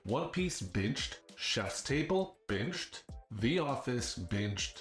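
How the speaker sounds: drawn-out, deliberate list; slow listing. Acted. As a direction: linger on each word. Listing binged shows, drawn out.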